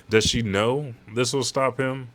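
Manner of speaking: ordinary delivery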